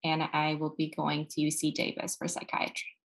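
ordinary talk, nothing marked

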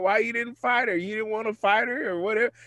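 high-pitched imitation of goading buddies; mock teasing. Buddies ribbing him for not fighting her, mock taunting. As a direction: needle him like his amused friends. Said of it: "and they're still laughing".

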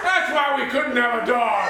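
loud, high outburst; outraged realization. Acted. Father learning his daughter's animal secret, loud and outraged. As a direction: cry it out as the truth dawns.

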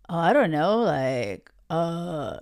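low mock player voice, fading out; clueless player. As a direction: stall like a guy with no answer. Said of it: "if he doesn't have clarity".

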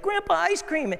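put-on high-pitched kid's call for ice cream; excited kid. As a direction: squeal it like a little boy begging. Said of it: "I'd be like".

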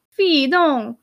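high, drawn-out French scolding; Hortense. A narrator's reading. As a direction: exclaim in French, reproving. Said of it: "began Hortense".

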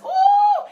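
sudden high preacher's whoop; high ecstatic whoop. Real, not acted. Preacher whooping to the congregation, high joyful cry. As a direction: cry out in joy.

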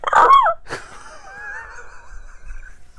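high squealing yelp; puppy yelp imitation. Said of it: "when he falls, he's like".

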